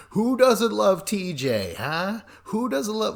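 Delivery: loud, high, drawn-out taunt; mock heckling. Castmates ripping on the new podcaster, mock heckling.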